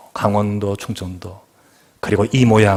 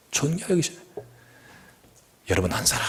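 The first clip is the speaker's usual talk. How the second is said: whisper; 3 semitones higher, 10 dB quieter than usual. Real, not acted. Sudden hushed sermon line.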